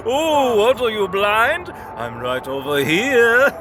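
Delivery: playful mock teasing. Balder teases blind brother.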